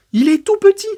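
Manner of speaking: very high, loud squeal of disbelief; high-pitched exclamation. Real, not acted. Exclaims at the titan's tiny size. (exclamation suraiguë et moqueuse)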